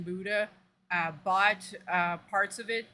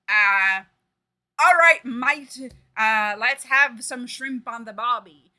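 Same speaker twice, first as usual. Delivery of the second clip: loud, hammed-up Australian; badly put-on Aussie twang, drawn out; Australian accent; 2 semitones higher, 10 dB louder, slower than usual. Streamer mocking what Coyle would supposedly say, Australian accent. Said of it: "Every time I try to sound fucking, uh, Australian".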